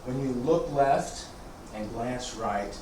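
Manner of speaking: quiet unhurried cues, guiding the form; serene tai chi cues. Acted.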